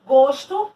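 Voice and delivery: gosto called out loud and high as the first example; emphatic first word. Shown as a demonstration. Teacher presenting homograph examples, emphatic. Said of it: "Aqui a gente vê o exemplo de".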